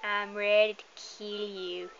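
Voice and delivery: slow, stretched spooky threat; little ghost voice. Acted. Player repeating the pink ghost's threat, slow ghostly voice. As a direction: draw the words out like a ghost. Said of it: "little, um, pink ghost is like".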